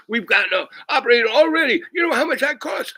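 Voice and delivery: high, loud outraged doctor voice; screaming doctor. Retold or imitated. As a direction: shriek at the patient about the cost. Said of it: "He's screaming at me".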